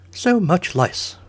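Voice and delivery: high, slow, eager aside; needless chime-in. Acted. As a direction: pipe up with something nobody needed. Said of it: "Merlin said unnecessarily".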